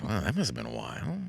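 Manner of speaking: hushed, creepy, fast true-crime host; soft ominous Dateline narrator; Keith Morrison. Retold or imitated. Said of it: "He goes".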